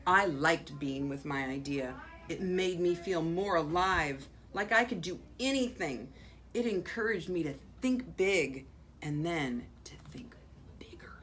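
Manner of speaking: expressive read-aloud with swelling key words; animated picture-book reading with deliberate pauses; story narration. A narrator's reading. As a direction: read to children, punching the important words. Reads picture book aloud to children.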